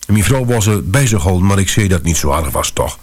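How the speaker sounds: ordinary delivery, nothing marked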